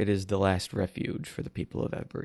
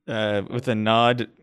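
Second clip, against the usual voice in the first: ordinary talk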